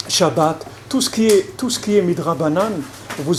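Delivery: ordinary talk, nothing marked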